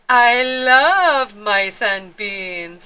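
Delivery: slow, loud relish; hammed-up delight; savouring. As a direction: linger on the mice lovingly. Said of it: "said Skipito".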